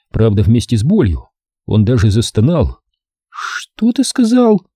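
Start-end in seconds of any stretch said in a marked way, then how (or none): 3.3-4.6 high, slow startled ask; sudden question. A narrator's reading. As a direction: suddenly alert. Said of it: "вдруг спросила Рен".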